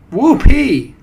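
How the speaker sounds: very high, loud whoop; shrieking cheer from adoring followers; jubilant cry. A narrator's reading. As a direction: whoop with delight. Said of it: "cried the witches".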